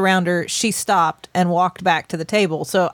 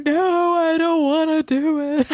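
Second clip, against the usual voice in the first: put-on much higher whiny child voice; whiny kid refusal; 8 semitones higher, slower than usual. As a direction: whine like a kid who won't go.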